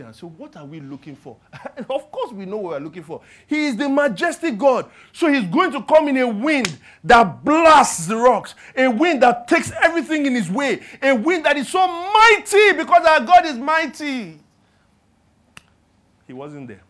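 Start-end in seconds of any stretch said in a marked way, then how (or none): 3.5-14.4 louder, higher preacher's build; preaching at full force. Acted. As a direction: preach the mighty wind, swelling up. Preaching the mighty wind.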